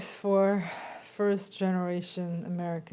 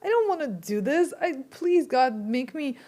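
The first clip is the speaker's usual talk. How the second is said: mock plea to God; 5 semitones higher, 5 dB louder than usual. Pleading retold inner thought.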